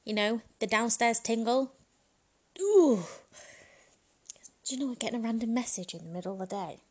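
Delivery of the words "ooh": high, loud thrilled ooh; excited ooh. Real, not acted. Thinking of a dirty text, a giddy high ooh. Describing the downstairs tingle.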